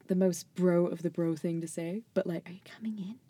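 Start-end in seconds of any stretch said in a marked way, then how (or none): 2.5-3.2 soft, hushed coaxing; creepy-sounding hushed invitation; whispering. Real, not acted. Host calling the cat Archie in, whispering. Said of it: "being creepy and whispering".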